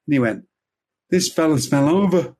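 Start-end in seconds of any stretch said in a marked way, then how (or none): 1.1-2.3 "fella's fell over" garbled, pitched up; mock speech impediment. Punchline from the man behind the screen, "fell over" in a mock speech impediment. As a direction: mangle the consonants of "fell over" in a higher voice. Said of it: "Man with a speech impediment".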